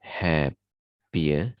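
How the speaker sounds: "Happier" split with a long pause after "hap"; "Hap... pier", testing the weak ending; syllable by syllable. Shown as a demonstration. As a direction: sound out "Happier" in two slow pieces. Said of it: "termasuk pada weak syllables".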